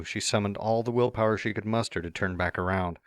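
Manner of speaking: plain reading voice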